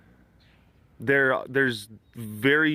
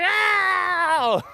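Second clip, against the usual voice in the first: high sudden mock yelp; healed person cry; 4 dB louder than usual. The healed believer crying out, mock yelp. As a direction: yelp like someone just healed. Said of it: "some faith healer".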